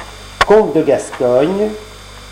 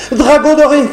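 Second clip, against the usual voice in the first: high, loud cry for help; 12 semitones higher, 5 dB louder than usual. Acted. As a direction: call out to him in alarm. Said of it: "cria la demoiselle".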